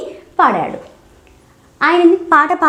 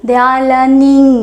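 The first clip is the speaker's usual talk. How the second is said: they are learning drawn out slowly for learners; deliberate teacher pacing; slow model phrase; 4 dB louder, much slower than usual. Shown as a demonstration. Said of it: "teacher models then repeats".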